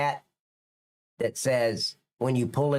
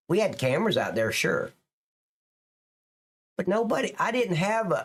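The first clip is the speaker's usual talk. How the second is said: usual speaking voice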